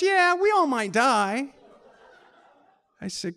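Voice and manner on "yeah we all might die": higher, louder, laughing it off; laughing joke. Retold or imitated. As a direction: toss it off as a joke. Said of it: "She goes".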